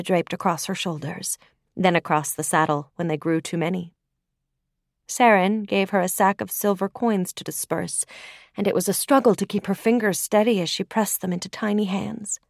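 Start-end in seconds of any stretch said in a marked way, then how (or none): none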